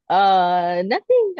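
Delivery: acting clueless; feigned ignorance. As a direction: play dumb after being caught, pitch leaping up. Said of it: "like if I didn't know".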